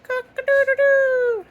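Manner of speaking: loud, high crowing call; rooster crow. Shown as a demonstration. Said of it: "What does a rooster say".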